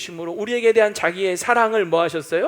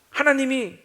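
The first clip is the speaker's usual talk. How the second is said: demanding; 7 semitones higher, 6 dB louder than usual. Retold or imitated. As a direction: press God for proof. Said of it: "이러면서 따지는 거예요".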